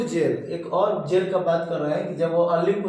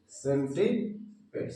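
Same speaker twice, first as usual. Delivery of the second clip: सेंटीपेड्स slowly, split in two parts; drawn-out teaching of सेंटीपेड्स; word broken up; 3 semitones lower, 4 dB quieter, much slower than usual. Shown as a demonstration. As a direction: say सेंटीपेड्स slowly with a gap before pads. Teacher breaks up new word.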